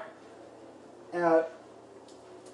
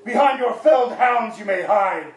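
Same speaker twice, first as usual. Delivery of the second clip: slow, loud, booming challenge; 5 dB louder than usual. Acted. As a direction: boom it out like a dwarf warrior. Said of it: "in a loud and booming voice".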